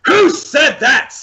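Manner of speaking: slow, high-pitched startled yell. Acted. Upside-down captive answers the goblin guard, yelling. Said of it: "You yell that?".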